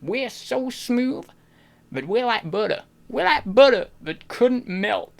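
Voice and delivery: slow, exaggerated southern drawl; southern accent. Shown as a demonstration. Streamer showing chat a southern accent, slow smooth drawl. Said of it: "I can do a good southern accent".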